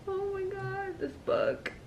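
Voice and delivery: very soft, spent murmur through tears; tearfully overcome. Real, not acted. Reader overwhelmed by a book's ending, tearful and hushed. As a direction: barely get it out, overcome. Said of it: "why am I crying?".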